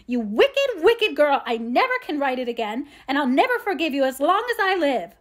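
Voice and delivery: loud, high-pitched passionate outburst; grief-stricken anger. A narrator's reading. Amy's furious sister crying over her burned book, passionate grief and anger. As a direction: cry it out in furious grief, voice leaping up. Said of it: "crying in a passion of grief and anger".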